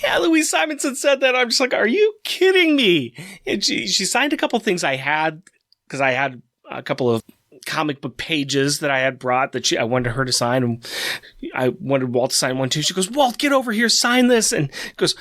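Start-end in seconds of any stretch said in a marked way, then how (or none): 1.7-3.1 stunned disbelief. Retold or imitated. Stunned at special treatment. 13.1-14.5 calling out. Retold or imitated. Calling husband over.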